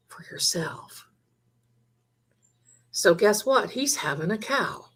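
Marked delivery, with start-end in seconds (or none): 0.1-1.1 soft unvoiced whisper; whispered aside. Real, not acted. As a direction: drop to a whisper for the last words. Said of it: "quiet closing emphasis".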